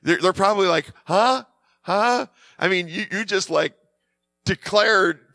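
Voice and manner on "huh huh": high, stunned "huh?"; dazed shock. Retold or imitated. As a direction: imitate someone too shocked to take it in. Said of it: "in a state of shock".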